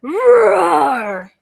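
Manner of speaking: loud, high, long dragon roar; rolled play roar, falling away; monster-scaring roar. Pretend. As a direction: roar big to scare the monster. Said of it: "And again, ready?".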